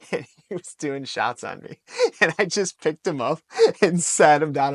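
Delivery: amused retelling broken by laughs; laughing while talking. Real, not acted. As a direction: tell it through real laughter, pitch rising. Laughing while telling funny story.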